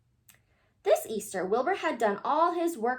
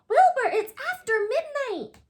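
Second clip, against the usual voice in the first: high and loud warning; urgent shout; 12 semitones higher, 6 dB louder than usual. A narrator's reading. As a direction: shout the late hour at him. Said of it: "shouted Francine".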